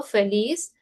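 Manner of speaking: normal talking voice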